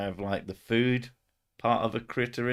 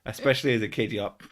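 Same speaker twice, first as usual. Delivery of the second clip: usual speaking voice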